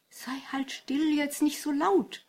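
high, hushing plea from the mother; pleading. A narrator's reading. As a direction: beg him to keep it down, pitched high. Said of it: "bat die Mutter". (hoch und flehend gebeten)

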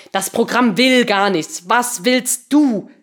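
loud, slow, emphatic demand; angry shout. A narrator's reading. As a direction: shout it word by word, exasperated. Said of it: "schrie Patricia".

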